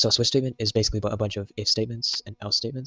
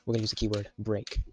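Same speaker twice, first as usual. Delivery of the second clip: normal talking voice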